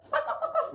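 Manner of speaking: high-pitched mimicked toy squeaks; squeaky toy imitation. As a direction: squeak like a dog toy, amused. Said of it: "She started squeaking the toy".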